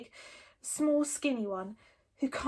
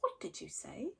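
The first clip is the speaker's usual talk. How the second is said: much softer menacing hiss; 2 semitones lower, 10 dB quieter than usual. A narrator's reading. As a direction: Fireworm hissing at Toothless. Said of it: "hissed Fireworm".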